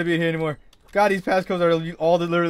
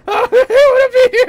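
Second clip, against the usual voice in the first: laughing shout; 14 dB louder than usual. Real, not acted. Laughing through scared yelling.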